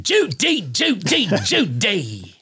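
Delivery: put-on high, clipped-free old-movie Cary Grant voice; cheesy retro celebrity impression; hacky Cary Grant. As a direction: do a deliberately bad Cary Grant. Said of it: "we're talking to Cary Grant".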